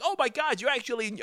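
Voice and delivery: high-pitched mock awe; imitated amazed onlookers. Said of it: "everyone's like".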